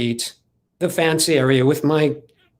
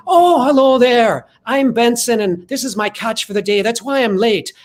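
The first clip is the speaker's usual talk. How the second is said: hammy entrance; 6 semitones higher, 4 dB louder than usual. Retold or imitated. Bursting into the audition room, a higher, louder hammy greeting. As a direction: burst in and present yourself like a hammy fisherman.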